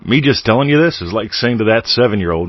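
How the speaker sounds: ordinary talk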